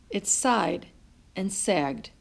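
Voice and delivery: plain reading voice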